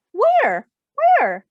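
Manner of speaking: high, loud, rising "Where? Where?"; bewildered. Acted. Pinky searching around.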